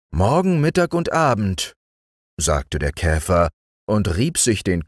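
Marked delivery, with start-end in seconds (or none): 0.1-1.7 lightly high beetle voice; musing beetle. A narrator's reading. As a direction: mull over the words as the beetle. Beetle repeating.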